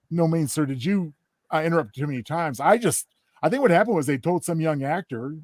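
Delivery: normal talking voice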